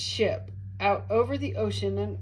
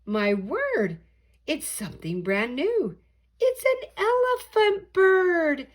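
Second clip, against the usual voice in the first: amazed shout; 10 semitones higher, 6 dB louder than usual. Acted. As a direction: build to wonder at the elephant bird. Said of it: "they shouted".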